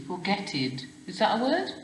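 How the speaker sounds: ordinary talk, nothing marked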